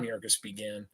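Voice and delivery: ordinary delivery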